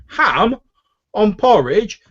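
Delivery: incredulous high, loud shout from afar; Finnan. Acted. Half-elf cook questioning the order from the back, loudly incredulous. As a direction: call back in high-pitched disbelief. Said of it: "you hear Finnan's half-elven voice from the back".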